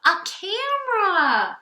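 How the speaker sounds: high, loud, slow and thrilled; a lucky find shown off with delight; excited. Acted. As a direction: marvel at the treasure. Franklin thrilled at his find.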